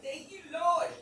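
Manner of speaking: high, loud Thank you Lord; mock preacher praise. Thanking God for blessings, in a loud high praise. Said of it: "I ain't gonna preach to you".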